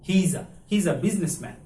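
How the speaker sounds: "he is a" run together as one sound; linked pronunciation. Shown as a demonstration. Teacher shows how to link "he is a" together. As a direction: blend "he is a" smoothly. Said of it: "is a একখানে করে".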